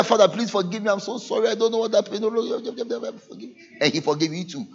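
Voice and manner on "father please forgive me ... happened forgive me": softer sing-song begging, trailing off; mock-plead for forgiveness; hammed-up sorry servant; pleading penitent. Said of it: "The same way he said".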